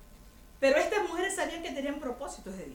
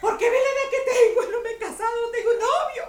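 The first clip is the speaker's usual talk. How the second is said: whiny single sister; 8 semitones higher, 9 dB louder than usual. Retold or imitated. Single sister wailing to the pastor about her age, high whiny voice. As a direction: play the tearful spinster, half-laughing. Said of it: "Lloraba por eso".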